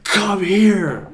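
high, loud calling out; acted-out excited holler; retold yell. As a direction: call a friend over urgently. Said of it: "I, like, yelled at Ryan".

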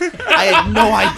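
laughing loud and high; laughing in disbelief. Real, not acted. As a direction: say it through a real laugh. Laughing over the bleep request.

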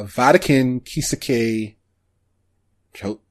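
ordinary talk

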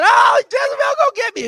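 high, loud mock-terrified cry; hammed-up fright; mock panic. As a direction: squeal it like a scared man fleeing. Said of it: "And he said".

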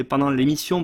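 normal talking voice

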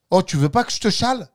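plus fort et plus haut, comme à un copain; hèle un ami pour lui proposer de monter; interpellation jouée. Acted. Exemple d'invitation en scooter. (interpellation vive à la marseillaise)

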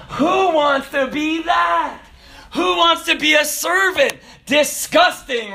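high, loud, drawn-out outrage; mock disgust. Kids scorning the servant career, loud mock disgust. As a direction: ham up appalled disbelief.

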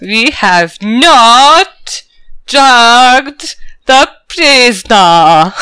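"not" and "drugged" very slow, with a pause between; pointed denial. Acted. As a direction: spell out "not drugged" with heavy, knowing emphasis. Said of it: "look at me very carefully".

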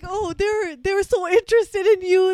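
high, loud scolding dad voice; exasperated father, mock lecture; imitating dad. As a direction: do your dad nagging you. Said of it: "he's like".

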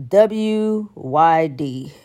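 very slow, letter by letter; spelled-out letters. Shown as a demonstration. Scorning a lazy text, slow spelled-out "W Y D". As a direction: spell out "W Y D" slowly, one letter at a time. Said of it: "don't hit me up with that W Y D".

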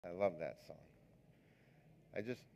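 ordinary talk, nothing marked

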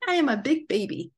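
lightly voiced babyish cat; voicing the cat. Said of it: "voicing the cat".

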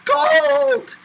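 high squeaked yelp; boy under the cold shower, squeaking high and loud. Acted. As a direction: squeak it from the shock of cold water. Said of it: "and squeaked".

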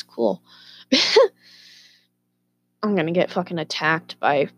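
ordinary talk, nothing marked

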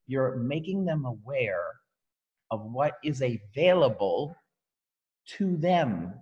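slow, deliberate "making them aware"; slow emphasis. Shown as a demonstration. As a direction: stretch each word out. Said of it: "I specifically slow down on certain words".

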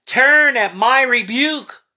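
commanding call. Acted. Preacher voicing God's command.

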